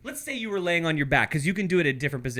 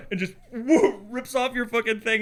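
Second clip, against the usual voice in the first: usual speaking voice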